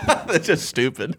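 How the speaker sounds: slow, giggling remark; laughing. Real, not acted. Friend reacts to the fish story, laughing slowly.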